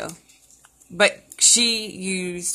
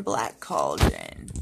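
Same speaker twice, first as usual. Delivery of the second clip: much lower, slow witchy intoning; deep witch voice; much slower than usual. Pretend. Chanting witch words.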